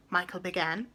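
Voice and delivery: ordinary delivery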